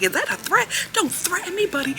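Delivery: high-pitched mimicked challenge; imitated warning, pitched well up; retold confrontation. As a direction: act out the manager squaring up to a customer. Acted manager confronting the guy.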